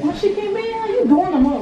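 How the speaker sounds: high, loud excited yelling. Real, not acted. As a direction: shout it across the room. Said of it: "Stop yelling".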